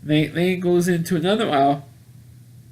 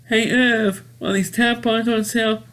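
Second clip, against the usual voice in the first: high-pitched cashier voice; cashier calling out; 6 semitones higher than usual. Retold or imitated. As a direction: imitate a cashier paging a coworker. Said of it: "the woman in the cashier".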